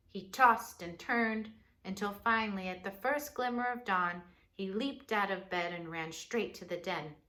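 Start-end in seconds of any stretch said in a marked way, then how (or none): none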